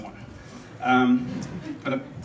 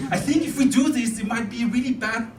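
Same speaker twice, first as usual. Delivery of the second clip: put-on high, strained German-accented warning; hesitant German soldier fumbling for words; struggling German officer; 7 semitones higher than usual. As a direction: plead with the general, pitched up. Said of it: "certain army".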